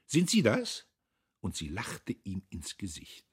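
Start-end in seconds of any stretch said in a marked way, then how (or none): 0.1-0.9 high, loud mocking questions; loud retort. A narrator's reading. Girl answers the man and laughs in his face, loud retort. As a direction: throw it back at him loudly. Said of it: "Sie erwiderte noch lauter".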